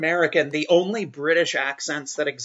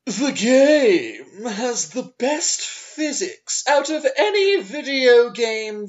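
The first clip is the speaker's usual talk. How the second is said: Duke Amiel impression; 10 semitones higher, 6 dB louder than usual. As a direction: declaim the comment grandly, rising to a loud peak. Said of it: "fucking excellent Duke Amiel".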